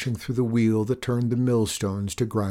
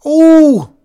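huge, very high, very loud bellow; desperate bellow; 16 dB louder than usual. A narrator's reading. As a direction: roar at the bear with everything she has. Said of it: "one last bellow".

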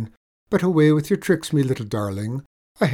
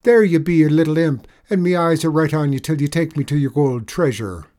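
loud, higher triumphant Irish voice; triumphant shout; 4 semitones higher, 7 dB louder than usual. Acted. Tradesman claiming the leprechaun's gold, loud triumph. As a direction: crow over the caught imp.

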